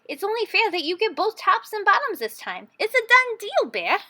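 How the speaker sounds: high, bright-eyed trickster voice; cheery Hare. A narrator's reading. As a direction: Hare waking his neighbor with a sly grin. Hare's voice in story.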